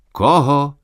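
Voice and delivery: puzzled, rising question; surprised. A narrator's reading. Student puzzled by the old man's question, surprised. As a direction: ask in plain surprise. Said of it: "ptal se udiveně".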